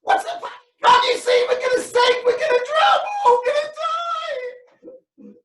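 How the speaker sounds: very high frightened shrieks; shrieking panic. Acted. Disciple answering Jesus in terror, very high panicked shrieks. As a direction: wail that they're all going to die.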